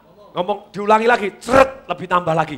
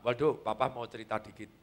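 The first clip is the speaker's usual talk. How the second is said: soft father; 8 semitones lower, 12 dB quieter than usual. Acted. Father quietly starting a story.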